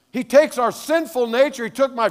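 loud, high, driving sermon voice; full-force preaching. Real, not acted. Pastor exhorting the men of God, loud and high.

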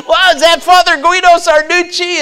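a mock starstruck squeal; amazed gawking. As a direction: gasp it out like seeing a celebrity. Gawking at priests in airport.

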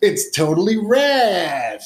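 high, drawn-out mock eighties slang; playful put-on eighties voice, swooping up; eighties slang talker. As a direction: stretch it out like a hammed-up eighties teen. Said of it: "I talk like I'm from the eighties".

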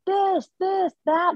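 high mock yell calling down; retold yelling. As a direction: call it out high across the arena. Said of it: "And she's yelling".

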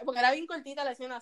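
usual speaking voice

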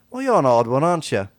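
high, loud urchin voice fawning over the dog; cheeky urchin sweet-talking a giant dog; street kid voice. Acted. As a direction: coo at the big dog like a scrappy street kid.

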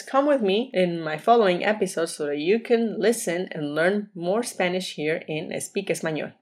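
ordinary talk, nothing marked

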